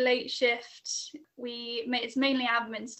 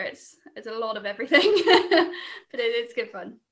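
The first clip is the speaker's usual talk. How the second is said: laughing; 8 dB louder than usual. Real, not acted. Laughing about the busy job.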